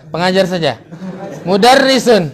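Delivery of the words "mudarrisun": loud, high drill call; teacher calling a drill word. Real, not acted. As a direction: call the word out for the class to answer.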